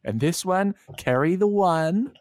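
slow, high, fussy student voice; nerdy student. Pretend. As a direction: work it out slowly like a nerd. Mocking someone doing long division.

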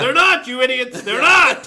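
loud, high-pitched exasperated outburst; exasperated shout. Real, not acted. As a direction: yell it in exasperation, stretching the words. Exasperated outburst at confused people.

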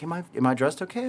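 a hint of an unsure girlfriend; imitated girlfriend. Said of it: "she was like".

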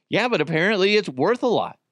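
usual speaking voice

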